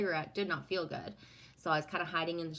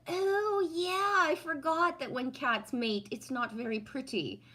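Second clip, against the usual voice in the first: high-pitched "oh yeah" of remembering; retold realization; 8 semitones higher than usual. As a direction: jump up high, then ease down. Retells her sudden realization.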